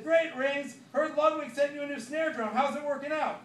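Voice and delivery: calling out. Acted. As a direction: call across the room to a mate. Calling across the club.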